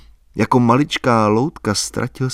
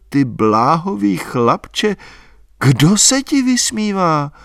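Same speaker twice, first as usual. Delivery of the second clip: slow, much higher, fondly scolding; higher girl's voice, slow and tender; 7 semitones higher, slower than usual. A narrator's reading. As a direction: coo it like a fond girl. Albína voiced in the story.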